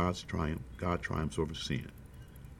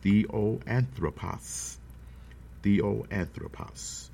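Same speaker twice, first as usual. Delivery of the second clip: very slow, syllable by syllable; slow dictation; 2 semitones higher, much slower than usual. Shown as a demonstration. Preacher gives note-takers a new word, slow dictation. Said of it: "write this word down".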